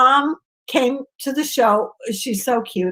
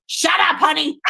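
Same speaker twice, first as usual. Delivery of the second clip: mom's voice; 3 semitones higher than usual. Retold or imitated. Mother voiced in story.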